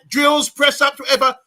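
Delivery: shouty football coach giving orders; bossy coach. Pretend. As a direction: bark it like a drill coach. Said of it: "And I'm like a coach".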